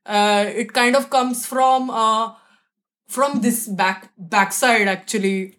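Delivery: a light Marathi English accent spoken from the back of the mouth; lightly voiced Marathi patriarch, sounds pulled back in the mouth. Shown as a demonstration. Guest plays a Marathi patriarch in a light accent. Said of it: "Notice how my accent and my speaking style also totally changes".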